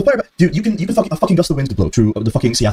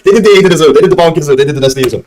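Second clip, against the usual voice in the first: ordinary talk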